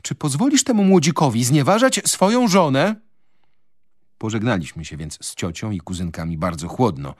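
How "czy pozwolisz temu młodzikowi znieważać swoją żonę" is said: high, loud offended cry; calling out indignantly. A narrator's reading. Wife demanding her husband defend her, calling out. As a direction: call it out, outraged and high. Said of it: "wołała do męża".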